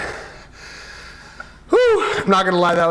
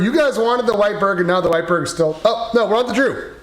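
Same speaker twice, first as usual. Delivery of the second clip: ordinary talk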